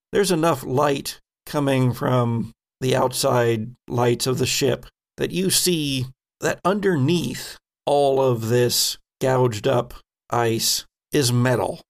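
usual speaking voice

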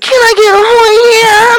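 very high, slow, stretched goofy voice. Retold or imitated. Mocking himself in a video, goofy high voice. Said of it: "You see my goofy ass, like".

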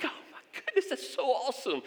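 very high mock amazement; hammed-up high-pitched wonder; awestruck listener. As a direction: gush like a gullible fan of the tale. Tyler awed by the punched shark.

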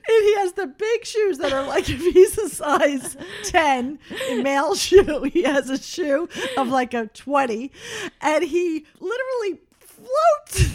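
high, breaking with laughter and crying; fighting tears of laughter to finish the story; laughing to tears. Real, not acted. Mom telling daughter about the clown, laughing to tears.

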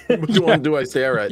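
laughing through the joke. Real, not acted. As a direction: giggle out the question.

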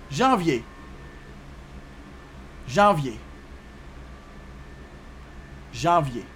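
Janvier said as zhahv-YAY, with a soft zh; Creole pronunciation. Shown as a demonstration. Teacher showing learners January in Creole, Janvier as zhahv-yay. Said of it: "you say it like this".

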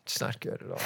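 hushed mock-grave whisper. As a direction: breathe it out gravely.

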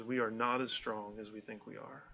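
preacher softly quoting a song line; soft quoted lyric. Retold or imitated. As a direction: murmur the lyric reflectively. Quoting a [name] lyric.

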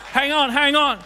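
loud, high call to stop the room; shouted hang on. Real, not acted. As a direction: shout to pull the congregation up short. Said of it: "preacher calling out".

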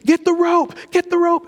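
high-pitched imitation of panicked yelling; mock screaming. People screaming in a chaotic storm scene, high mock scream. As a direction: cry out high like a panicked crew, without full volume. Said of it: "people are screaming".

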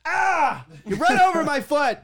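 high, loud cry of outrage; mock pained yell. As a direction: act out yelling over a crushed foot. Acting man whose foot got crushed.

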